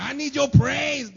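high, loud, slow mock shout; needy God shouting. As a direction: stretch it out like a whiny tyrant.

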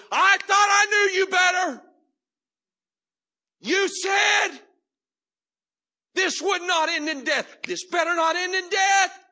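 high, loud, shouted accusation to Jesus; Mary crying out to Jesus in a full shout; shouted plea. Acted. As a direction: cry it out with everything you have. Mary's cry voiced, shouted.

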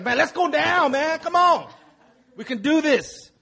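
rally the crowd like a hyped friend; mock pep talk. [name] cheering people on.

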